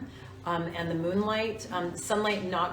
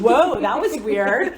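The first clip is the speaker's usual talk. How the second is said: surprised; 5 semitones higher, 12 dB louder, slower than usual. Real, not acted. Lights suddenly change.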